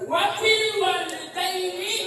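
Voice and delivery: high, loud, slow cry of the verse; emphatic recitation. Shown as a demonstration. Preacher drilling the congregation on the verse, high and loud. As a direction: proclaim the words to the whole hall.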